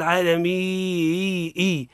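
slow "عالمي" with the long ii re-attacked as "ii"; stretched pulsing ending on "عالمي"; broken madd. Shown as a demonstration. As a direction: wrongly break "عالمي" into "mii-ii" with a glottal catch. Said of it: "خرجت عن أصل الكلمة".

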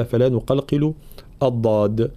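normal talking voice

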